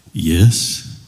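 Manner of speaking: low, slow voice from above; voice of God. Acted. God answers the hanging jogger, deep calm voice. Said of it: "A voice comes back".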